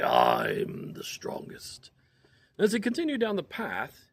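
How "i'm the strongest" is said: slow, low whispered boast. Acted. Bear boasting to himself in a slow low whisper. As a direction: breathe it out slowly like a smug bear.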